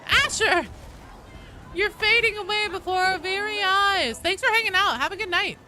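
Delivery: high, loud, theatrical send-off; called-out farewell. Real, not acted. Streamer calling goodnight to departing viewer, loud farewell. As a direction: cry out after someone leaving.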